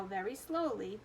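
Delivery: ordinary delivery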